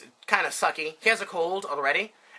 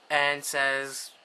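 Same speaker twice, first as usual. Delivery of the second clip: ordinary talk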